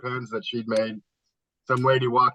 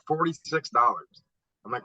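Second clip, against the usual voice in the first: ordinary talk, nothing marked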